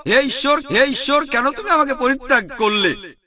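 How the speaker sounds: high imploring cry; mild anguished lament; anguished plea. Retold or imitated. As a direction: plead as if forsaken. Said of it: "তিনি বলেছিলেন".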